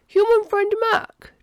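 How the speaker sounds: high, loud, slow address; alien addressing friend. A narrator's reading. Alien interrupts lunch to question friend, addressing him. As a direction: get a friend's attention in a formal alien voice.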